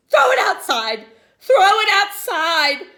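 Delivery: high, loud desperate cry; frantic pleading. A narrator's reading. Girl urging teacher to throw worm out, shrieking. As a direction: beg and shriek it twice.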